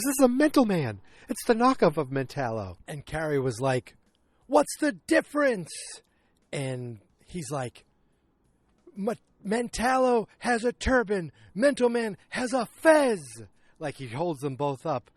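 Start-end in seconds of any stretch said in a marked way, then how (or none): 0.0-2.8 high, loud opening, dropping back down; mock outrage at being fooled; indignant retort. Man objecting to the knockoff gift, indignant. 4.5-5.7 high, loud mock exasperation; exasperated retort. Carrie answering the knockoff complaint, exasperated. Acted quarrel over the gift. 8.9-13.2 high, loud, slow mock outrage; indignant outburst. Man explaining the two toys' difference, indignant.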